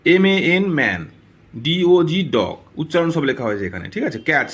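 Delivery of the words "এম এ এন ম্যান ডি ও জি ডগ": the letters of "ডগ" spelled slowly, then the word; slow dictation; slow spelling. Shown as a demonstration. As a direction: dictate it slowly for pupils to copy. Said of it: "উচ্চারণ হবে cat".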